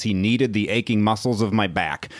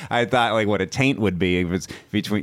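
ordinary talk, nothing marked

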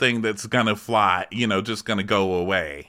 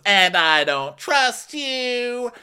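high, very slow drawn-out mock line; mock Rinna; 13 semitones higher, much slower than usual. Rinna answering Andy, high slow mock. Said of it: "And Rinna goes".

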